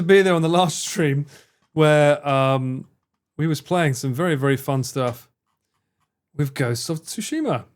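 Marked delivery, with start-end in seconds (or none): none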